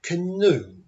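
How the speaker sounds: CANOE with barely any vowel after C, second syllable high; reduced first vowel. Shown as a demonstration. Teacher giving practice word, CANOE with schwa first. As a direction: jump over the A in CANOE, stress NOE. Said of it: "We're going to jump over".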